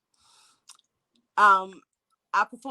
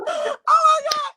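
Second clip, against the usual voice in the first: laughing; 5 dB louder, slower than usual. Real, not acted. Laughing at own story.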